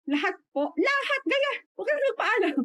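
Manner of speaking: mataas at masiglang ulit; masiglang pagpayag. Retold or imitated. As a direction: mas mataas na masiglang pag-uulit. Ginagaya ang pagpayag ni Sheila. (masiglang pag-uulit, mataas ang tono)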